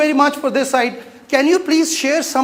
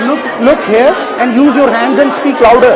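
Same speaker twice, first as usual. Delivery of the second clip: loud, insistent call to the speaker; loud coaching call; 4 semitones higher, 3 dB louder than usual. Real, not acted. Teacher urging student at the front, loud calls. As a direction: call over the room to make her project. Coach shouting instructions mid-speech.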